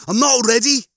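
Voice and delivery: high, loud, upset refusal; frustrated outburst, pitched high; distressed protest. A narrator's reading. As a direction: cry out against an unfair fate. Lister kicks the machine, protesting death.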